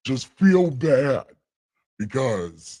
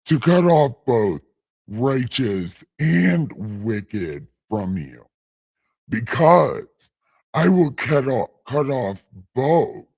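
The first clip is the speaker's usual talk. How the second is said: dramatic scripture reading; 2 semitones lower than usual. Acted.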